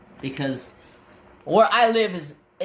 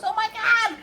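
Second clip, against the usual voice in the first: high-pitched mock squeal; excited fan. Cashier recognizing his surname, high excited imitation.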